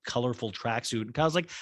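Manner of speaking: ordinary delivery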